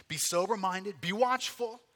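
rousing charge, pitch rising to the last word; charging command. Acted. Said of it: "he start charging us".

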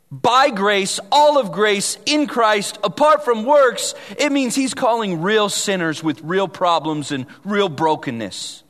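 normal talking voice

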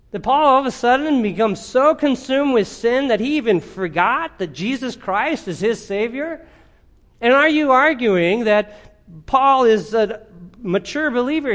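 high, loud rhetorical challenge; forceful preaching. Real, not acted. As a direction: press the argument hard, easing off at the end.

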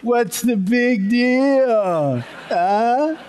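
high, slow teasing taunt; drawn-out high mock challenge. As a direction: needle the nervous audience playfully. Teasing nervous public speakers.

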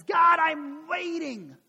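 high, loud, drawn-out complaint; acted fed-up kid; impatient yell. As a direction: yell it skyward, stretching the words. Kid loses patience with God.

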